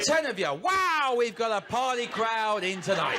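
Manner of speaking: high, loud mock-impressed wow; hammed-up excitement at a feeble turnout; mock amazement. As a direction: whoop it up as if hugely impressed. Mocks the crowd's small response.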